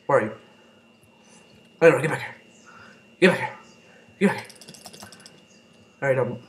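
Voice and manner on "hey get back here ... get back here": shouting chase. Real, not acted. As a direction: yell after a fleeing foe. Chasing an enemy.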